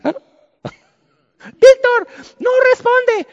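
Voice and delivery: high, loud panicked call of his name; alarmed call. Retold or imitated. Wife calling her collapsed husband, high loud alarm.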